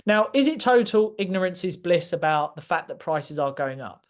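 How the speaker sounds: ordinary delivery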